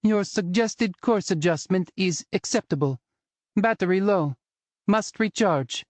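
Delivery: flat old-time robot voice, mocking; high mock robot, flat and stiff. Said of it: "mocking him in a flat, old-time robot voice".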